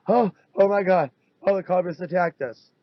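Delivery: hammed-up staged victim; mock panic. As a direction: fake a startled cry. Staged panic after car attack.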